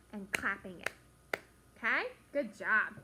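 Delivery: ordinary talk, nothing marked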